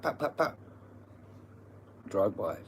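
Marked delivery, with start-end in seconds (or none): 0.0-0.6 rapid popping gunshot noises; imitated gunfire. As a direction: mimic shots with short pops of the voice. Imitates gunfire of drive-bys.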